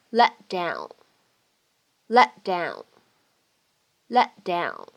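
"let down" with the t in "let" held, not released; unreleased t. Shown as a demonstration. Teacher demonstrating lost plosion, "let down" with unreleased t. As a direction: run "let down" together, stopping the t silently. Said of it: "可以有一个失去爆破的处理".

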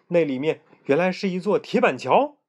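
ordinary delivery

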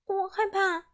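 high, tiny scared voice; a little girl admitting fear; small trembling voice. Acted. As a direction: barely get it out, frightened. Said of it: "达芙妮用细小发抖的声音说".

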